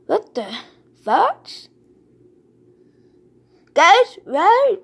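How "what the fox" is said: normal talking voice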